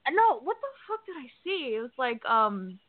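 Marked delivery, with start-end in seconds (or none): none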